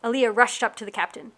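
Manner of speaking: ordinary delivery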